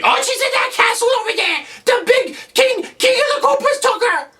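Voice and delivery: very high, frantic falsetto; panicked little kid. Acted. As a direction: squeal out the alarm in a tiny kid voice. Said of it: "these little kids".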